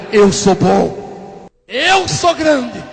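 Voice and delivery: gabar-se devagar, subindo para um brado agudo; ego se gabando. Retold or imitated. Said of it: "eu dizia no meu ego". (orgulhoso, bem arrastado)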